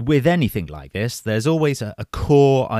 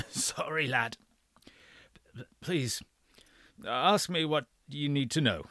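put-on frail breathless old person; 5 semitones higher, 9 dB quieter than usual. A narrator's reading. Dying old man after coughing.

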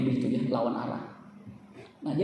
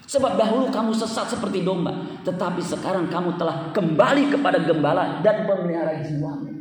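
loud, raised proclamation; forceful preaching; 5 semitones higher, 4 dB louder than usual. Acted. Preacher quoting scripture to the congregation, proclaiming loudly. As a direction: declare the verse with force.

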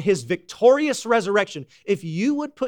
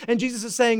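normal talking voice

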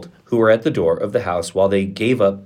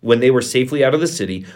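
usual speaking voice

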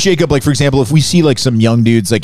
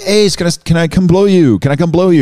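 mock girls yelling; 7 semitones higher than usual. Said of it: "Girls outside yelling".

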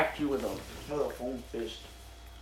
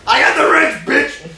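high, loud, hammed-up battle taunt; mock threat; 8 semitones higher, 10 dB louder, slower than usual. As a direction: wave the wrench and yell like a movie tough guy. Mock fight, brandishing wrench.

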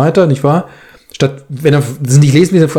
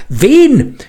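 outraged shout of one word; furious demand; 10 semitones higher than usual. Acted. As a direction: bark the single word at him while shaking him. Writer shakes narrator, demands name.

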